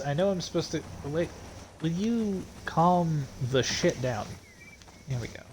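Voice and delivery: usual speaking voice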